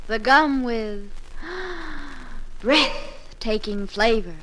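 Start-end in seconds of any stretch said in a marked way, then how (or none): 1.2-2.6 quick sharp intake of breath; sharp gasp. Acted. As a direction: gasp in delight.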